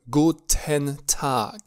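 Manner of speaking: "guten Tag" slowly, with a full "ten" syllable. Shown as a demonstration. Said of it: "dacă este pronunțat mai lent".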